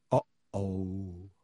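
low, soft dread; said into silence. A narrator's reading. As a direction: drop it low and small. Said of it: "said Ron into the silence".

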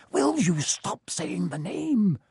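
sharp, slow, urgent hiss. Acted. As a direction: hiss it through your teeth. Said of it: "Ron hissed".

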